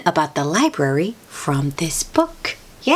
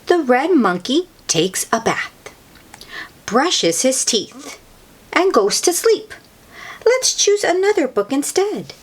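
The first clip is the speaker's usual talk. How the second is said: put-on much higher little piggy girl, bored of the old book; Peppa Pig voice; 6 semitones higher than usual. Peppa asking Mummy for a different story, high childlike voice.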